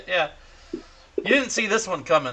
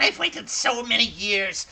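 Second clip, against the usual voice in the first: higher, gleeful crone voice; excited crone; 6 semitones higher than usual. Acted. Old crone telling the boy she waited years, higher glee. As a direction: gush like a hag at the end of a long wait. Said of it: "And she's like".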